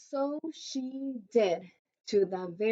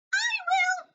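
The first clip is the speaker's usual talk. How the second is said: eager cat. Acted. Hungry cat crying out for cake, very high and eager. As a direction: jump at the chance.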